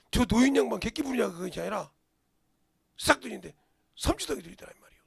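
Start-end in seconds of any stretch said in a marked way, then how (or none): none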